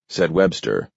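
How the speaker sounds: usual speaking voice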